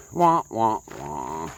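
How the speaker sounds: falling mock wah-wah; sad trombone wah. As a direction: sing a deflating wah wah wah.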